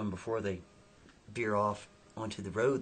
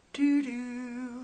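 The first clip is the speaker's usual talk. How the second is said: high eerie two-note hum; spooky sound cue; 13 semitones higher, 4 dB louder than usual. Acted. Storyteller to listener after the stare, eerie two-note hum. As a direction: hum a creepy movie sting.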